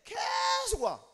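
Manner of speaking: long, loud, high stretch on 계속; exasperated drawl. Acted. Passenger waiting all day as trains keep coming, exasperated drawn-out cry. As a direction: drag out 계속 loudly to show it never ends.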